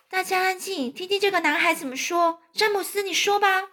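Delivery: high, light character voice; high-pitched, restrained coaxing; gentle hushing ladybug. Acted. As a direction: hush them sweetly in a high voice. Ladybug hushes crowd, coaxes boy.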